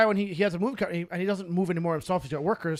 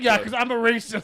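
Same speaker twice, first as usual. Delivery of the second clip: sarcastic quote; 4 semitones higher, 5 dB louder than usual. Retold or imitated. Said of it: "Tim's like".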